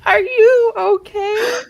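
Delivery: high, loud and slowed by laughter; laughing. Real, not acted. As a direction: ask through giggles. Laughing concern.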